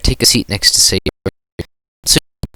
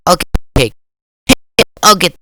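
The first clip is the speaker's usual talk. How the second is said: put-on girl voice; 8 semitones higher, 4 dB louder than usual. Voicing Natsuki in scene.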